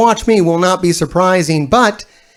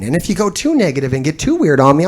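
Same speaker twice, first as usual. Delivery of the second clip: ordinary talk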